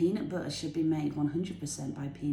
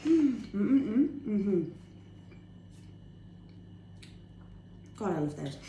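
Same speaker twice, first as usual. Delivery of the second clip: talking through a mouthful; chewing while talking; mouth full; 2 semitones higher, slower than usual. Real, not acted. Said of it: "I'm gonna talk now with my mouth full".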